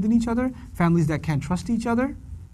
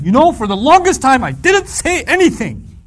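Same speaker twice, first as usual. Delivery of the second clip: higher, louder boasting; proud complaint; 7 semitones higher, 7 dB louder than usual. Retold or imitated. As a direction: claim it like a virtue. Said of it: "he explains".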